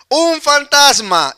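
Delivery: high, loud, stretched-out exclamation; grito de miedo imitado. Retold or imitated. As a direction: act out a frightened cry. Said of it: "Y dieron voces de miedo". (exclamación de miedo, aguda y alargada)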